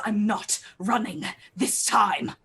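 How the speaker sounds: emphatic, defiant in-character line; fear turned rage. Acted. Paladin stabbing the monster's back, fear turned to rage. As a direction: spit out each phrase with mild fury. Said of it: "absolute fear that turns into rage".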